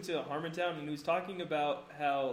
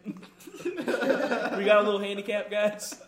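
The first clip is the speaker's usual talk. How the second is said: southern drawl; 3 semitones higher, 5 dB louder, slower than usual. Retold or imitated. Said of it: "southern guy was like".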